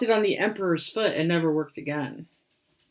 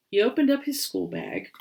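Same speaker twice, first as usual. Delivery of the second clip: ordinary delivery, nothing marked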